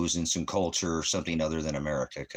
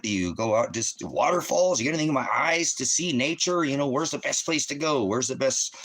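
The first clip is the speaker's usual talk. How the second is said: higher, eager tourist greeting, lightly acted; friendly tourist; 6 semitones higher than usual. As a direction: play yourself cheerfully chatting up locals. Acting out chatting up locals.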